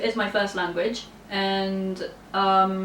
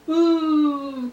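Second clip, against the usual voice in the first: ooh; 7 semitones higher, 4 dB louder than usual. Real, not acted. Reacting to being called stubborn.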